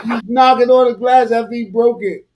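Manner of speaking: high, loud, laughing exclamation; shrill laughing outburst; laughing disbelief. Real, not acted. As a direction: crack up in disbelief. Cracking up at the clip.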